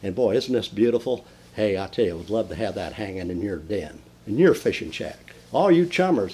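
ordinary delivery

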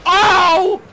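high, loud burst of surprise; shocked laughing yelp. Real, not acted. Streamer yelping in shock at a play. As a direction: jolt out a laughing oh.

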